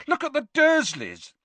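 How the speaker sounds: high, heated outburst; indignant, pointed cry; furious. A narrator's reading. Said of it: "Harry said furiously".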